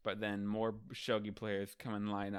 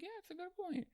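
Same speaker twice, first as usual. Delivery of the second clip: very high, soft falsetto; mock group agreement; 8 dB quieter, much faster than usual. Everyone agreeing with Luffy, small mock falsetto. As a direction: squeak it out as a tiny chorus of onlookers.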